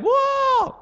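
high, slow startled cry; mock demon panic. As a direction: yelp like a demon seeing Jesus. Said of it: "demons freaked out around him".

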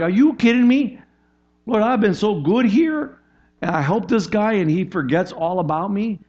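high, loud, bitter outburst to the Lord; bitter complaint. Retold or imitated. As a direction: play a man betrayed by his friend.

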